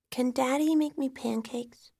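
put-on high-pitched innocent child; small child. A narrator's reading. Daughter asking for daddy's pancakes, small child's voice. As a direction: ask innocently in a little girl's voice. Child asks for dead father.

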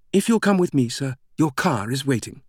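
high, eager British woman's voice; exuberant British greeter. A narrator's reading. British greeter leading Langdon to his car, exuberant and high. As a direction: usher them along with bubbly eagerness. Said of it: "The woman spoke with an exuberance that was almost unsettling".

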